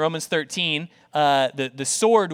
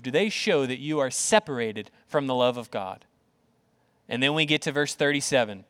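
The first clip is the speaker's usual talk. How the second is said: ordinary talk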